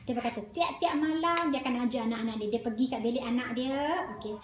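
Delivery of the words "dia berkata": ordinary talk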